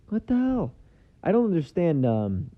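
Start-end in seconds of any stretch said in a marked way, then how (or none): 0.1-0.7 high-pitched comic disbelief; baffled outburst. Acted. Baffled viewer unable to follow the accent, high-pitched disbelief. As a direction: squeak it out, utterly lost.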